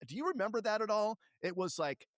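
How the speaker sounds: ordinary delivery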